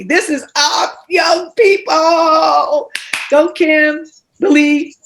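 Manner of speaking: rally the room behind her; excited cheer. Real, not acted. Host cheering a nervous young poet on, excited.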